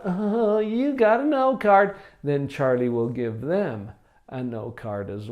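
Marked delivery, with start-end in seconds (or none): 0.0-2.0 sing-song teasing, pitched higher; mocking taunt. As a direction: taunt a classmate over the no card. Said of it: "if they give 'em a bad time about it".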